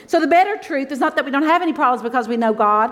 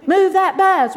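higher, louder "Move that bus", hammed up like the TV crowd; shouted catchphrase; 7 semitones higher, 4 dB louder, slower than usual. Pastor's wife imitating the TV show chant, louder and higher. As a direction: belt "Move" out like the show's chant. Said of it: "You know that show says".

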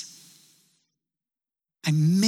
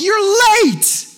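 high, loud mock complaint; a high, loud, hammed-up complaint from the waiting people; annoyed crowd; 9 dB louder than usual. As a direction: squeal it like an indignant crowd. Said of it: "people are like".

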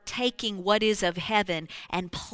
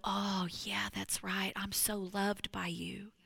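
grateful whisper; 7 dB quieter than usual. Acted. Voicing a believer's grateful response.

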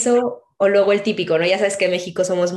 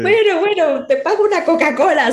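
exasperated retort; 12 semitones higher, 5 dB louder than usual. Retold or imitated. Exasperated at waiter over Coca-Cola.

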